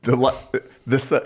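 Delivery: normal talking voice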